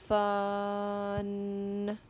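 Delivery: "Fun" with every sound held long; slow sounding-out. Shown as a demonstration. Teacher models a word for children, "Fun" drawn out slowly. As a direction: stretch "Fun" out slowly without breaks. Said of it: "saying the word slowly".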